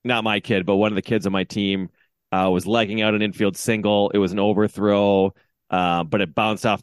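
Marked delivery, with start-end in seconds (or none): none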